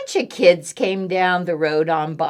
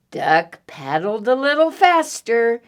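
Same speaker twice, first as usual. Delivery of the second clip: ordinary delivery, nothing marked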